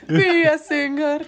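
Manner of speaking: laughing, high mock Italian accent; slow, breaking into laughter. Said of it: "sotaque horroroso da [name]". (sotaque italiano rindo)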